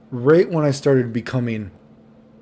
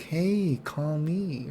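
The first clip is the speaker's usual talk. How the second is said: hushed, higher come-on; whispered under breath; 4 semitones higher, 7 dB quieter, much slower than usual. Retold or imitated. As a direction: imitate a furtive, soft flirtation. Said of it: "kinda, like, whispers under his breath".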